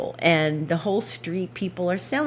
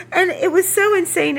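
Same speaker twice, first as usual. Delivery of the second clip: laughing; 12 semitones higher, 4 dB louder than usual. Real, not acted. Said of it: "I burst out hysterical laughing".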